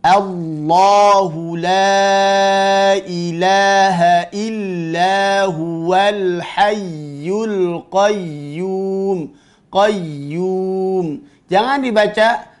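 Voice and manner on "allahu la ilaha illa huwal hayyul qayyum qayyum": very slow chant, holding YY in HAYYUL QAYYUM; flowing ya. Shown as a demonstration. Teacher modelling the verse, slow recitation with sustained doubled ya. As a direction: carry the sound unbroken through QAYYUM. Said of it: "Memiliki sifat namanya ar-rakhawah".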